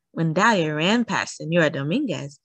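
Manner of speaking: ordinary delivery, nothing marked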